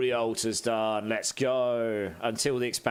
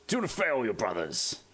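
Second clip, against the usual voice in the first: Space Marine battle cry. Retold or imitated. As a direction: bark it like a zealous space warrior. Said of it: "Do this one too".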